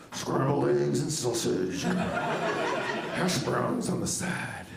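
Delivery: put-on slow, low, rasping growl of an old bluesman. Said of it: "I order it like I'm [name]".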